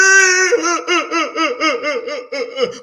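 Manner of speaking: high wailing laugh-cry shout; sobbing laugh-cry. Retold or imitated. Vlogger imitating [name] breaking down, mock sobbing wail. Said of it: "That was a good fucking impression".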